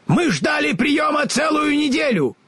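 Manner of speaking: very high, angry scarecrow; furious yell. A narrator's reading. Enraged Scarecrow shouts at the wizard.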